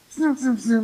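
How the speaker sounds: three quick high whooshes; imitated zooming cars. Imitates cars passing in left lane.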